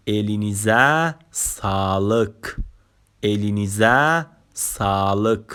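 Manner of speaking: الینیزه سالک said slowly as elinize sağlık; slow Turkish demo. Shown as a demonstration. Teacher models elinize sağlık slowly for learners. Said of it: "اصطلاحاتمون رو به ترتیب بخونیم".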